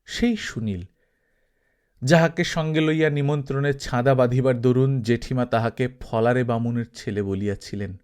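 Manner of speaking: ordinary delivery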